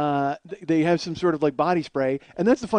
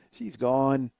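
normal talking voice

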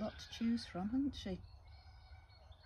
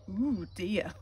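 mock disgust; 6 dB louder than usual. Reader reacts to pirates.

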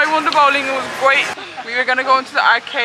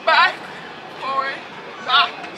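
usual speaking voice

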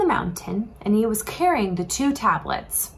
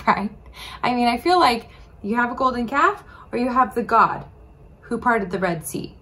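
ordinary talk

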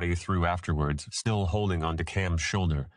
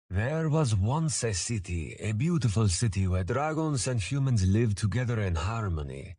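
creaking, ominous; 3 semitones higher than usual. A narrator's reading. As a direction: begin the tale like a creaky old teller. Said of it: "in a creaking, ominous voice".

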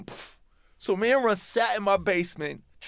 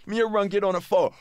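ordinary talk, nothing marked

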